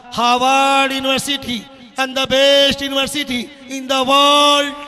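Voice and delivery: very slow, loud, stretched-out reading; loud proclamation. Real, not acted. Preacher reading a search result to the crowd, loud proclamation. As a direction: proclaim it grandly, drawing out each key word.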